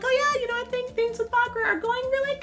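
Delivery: high, breezy fake brightness; fake cheerful lie. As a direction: cover the lie with a sunny tone. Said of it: "obviously she lies".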